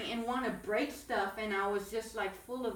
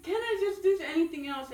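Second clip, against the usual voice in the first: tearful pleading; 7 semitones higher, 8 dB louder than usual. Retold or imitated. Pleading with husband, crying.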